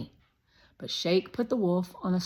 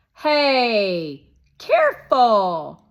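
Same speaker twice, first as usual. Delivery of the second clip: put-on high, loud pushy boy; Shake voice; 4 semitones higher, 12 dB louder, much slower than usual. A narrator's reading. Narrator voicing Shake, a loud, high pushy boy. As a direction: play the bossy guest kid, loud and high.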